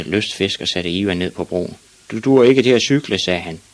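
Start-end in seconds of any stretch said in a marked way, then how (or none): 2.1-3.2 loud, higher telling-off; mildly indignant, raised voice; scolding fisherman. A narrator's reading. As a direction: tell the boy off loudly.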